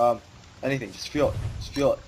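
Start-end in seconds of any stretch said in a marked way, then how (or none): none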